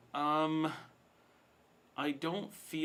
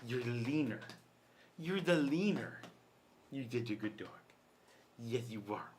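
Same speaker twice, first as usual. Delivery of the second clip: dog talk. Real, not acted. Cooing to the dog.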